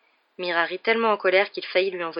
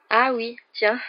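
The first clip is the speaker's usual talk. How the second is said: higher, failed attempt at enthusiasm; forced cheer; 5 semitones higher, 5 dB louder, much slower than usual. A narrator's reading. Ron pretends to be glad about spiders, forced cheer. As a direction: fake a cheerful tone and miss. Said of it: "en essayant sans succès de se réjouir".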